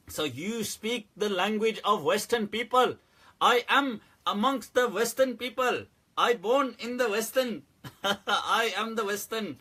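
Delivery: exaggerated broken English, laughing; mock broken English. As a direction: pretend to barely speak English. Mocking the viewer's broken English.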